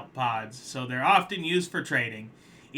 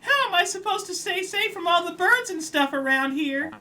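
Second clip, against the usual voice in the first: much higher, frantic pleading crab voice; desperate crab; 6 dB louder than usual. Acted. Crab protesting the repossession, high frantic voice.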